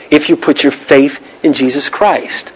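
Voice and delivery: ordinary talk, nothing marked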